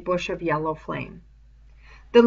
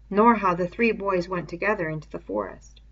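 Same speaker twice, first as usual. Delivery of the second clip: ordinary delivery, nothing marked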